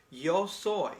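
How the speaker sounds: yo soy said out loud and clear; clear model phrase. Shown as a demonstration. Teacher modelling yo soy loudly for students. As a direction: present it boldly to the class. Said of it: "let's say yo soy".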